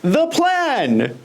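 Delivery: high-pitched mocking French accent; put-on French accent, sent up. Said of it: "Is this really how French people talk?".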